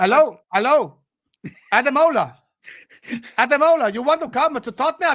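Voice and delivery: put-on accidental slip into comic Italian accent; lively Italian-accented sales pitch. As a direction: call out in hammed-up Italian English. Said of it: "why I'm doing an Italian accent".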